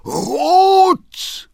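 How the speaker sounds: very high, loud, drawn-out cry; outraged scream. A narrator's reading. Father hearing his son is Red, screaming. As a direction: scream the word out in shock. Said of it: "schrie der Vater".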